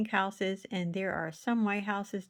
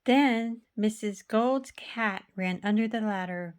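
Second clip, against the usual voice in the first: ordinary delivery, nothing marked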